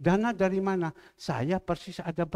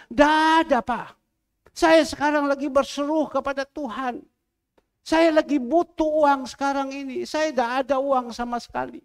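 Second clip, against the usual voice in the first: loud, higher pleading widow; 6 semitones higher, 4 dB louder than usual. Retold or imitated. Preacher voices the widow begging God, loud pleading. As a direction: cry out your need as the old widow.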